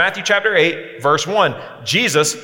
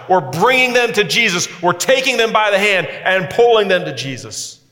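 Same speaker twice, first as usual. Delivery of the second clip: raised, rousing preacher's build; preaching at full force; 6 semitones higher than usual. Real, not acted. Preacher urging students to bring people to Jesus, rousing. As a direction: drive it home to the congregation, rising.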